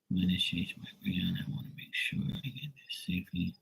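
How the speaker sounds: very soft, half-whispered murmur; reading under breath. Real, not acted. Host reads a viewer's trip question, under his breath. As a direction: murmur it to yourself as you read. Mumbling a viewer's message to himself.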